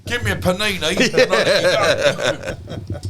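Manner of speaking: loud, very slow and laughing bribe demand; mock greedy copper. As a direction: drag it out like a cop hamming up a bribe.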